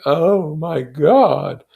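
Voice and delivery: ordinary delivery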